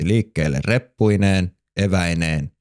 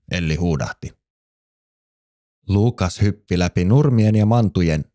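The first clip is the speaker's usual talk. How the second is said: ordinary delivery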